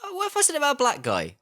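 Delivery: high, fast sarcastic whine; mock indignant voice. Moviegoer mocking the crowd, high mock outrage. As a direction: squeak it out in mock outrage, then drop flat.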